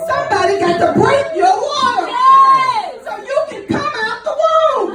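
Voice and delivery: preacher building to a cry; preaching. Acted. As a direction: drive the point home to the congregation. Preaching at full force.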